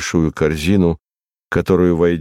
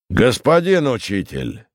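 higher-pitched summons; calling out; 7 semitones higher than usual. A narrator's reading. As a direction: hail someone across the room without shouting. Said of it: "крикнул он затем".

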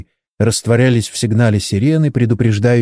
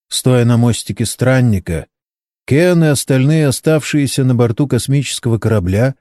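usual speaking voice

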